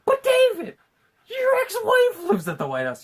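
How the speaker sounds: very high, loud put-on shout; hammed-up shrill father; mock shrieking dad. Father shouting at son about ex-wife, put-on shout.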